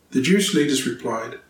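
ordinary delivery

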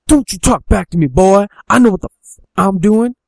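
loud, high scolding shout; angry hillbilly dad. Acted. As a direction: put the boy in his place at volume. Dad scolds his son back.

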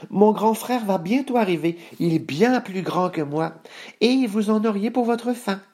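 high-pitched goat voice; middle billy goat, persuasive. Acted. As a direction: bargain up high, keeping cool. Moyen Bouc bargains with troll.

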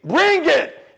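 high, loud, escalated dare; shouted battle challenge. Acted. Fighter daring his problems at full pitch, shouted challenge. Second challenge, higher and louder.

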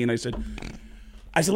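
normal talking voice

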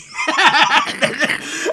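a high, breathy laugh bursting out; high burst of laughter. Real, not acted. Fan cracking up retelling a funny comic scene, laughing high. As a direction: crack up mid-story. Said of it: "I'm laughing right now talking about it".

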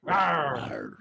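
mock dinosaur roar shout. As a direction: roar it like a playful dino. Dinosaur roar greeting exchange.

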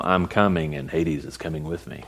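a hint of a low, quiet movie gunslinger; Clint Eastwood. Pastor quoting a western to his church, lightly low and quiet. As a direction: lightly growl it like a western hero. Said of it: "kinda like a Clint Eastwood movie".

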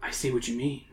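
whispering. Acted. Mister Scully noticing the fly, whispering. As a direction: drop to a near-whisper. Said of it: "he whispers".

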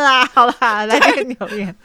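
words half cried out with laughter; laughing through words. Real, not acted. Cohost trying to start the comments while laughing. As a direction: still giggling, fighting to speak.

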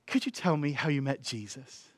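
very soft, careful question; gentle. Retold or imitated. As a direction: ask it tenderly, almost under your breath. Voices Heidi's gentle, probing question.

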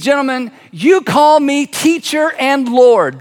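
high, slow, ringing declaration; solemn proclamation. Acted. Jesus to the disciples after washing their feet, proclaiming. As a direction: declare each word with weight.

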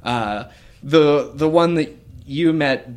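ordinary delivery

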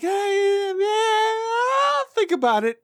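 high, drawn-out brush-off; mock the kid waving off advice; dismissive teen. Teen brushing off his dad's advice, high and drawn out.

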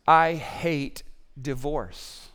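very slow, low and weighty; low solemn declaration with long, deliberate words; grave, strong statement. Acted. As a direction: spell it out slowly, word by word. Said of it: "one of the strongest statements".

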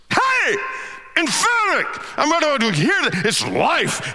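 high-pitched, intense emphatic cry. Shown as a demonstration. Preacher demonstrating what emphatic means, emphatic high cry. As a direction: belt it out with great power and purpose. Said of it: "Emphatic is when you're saying it with, with great intensity".

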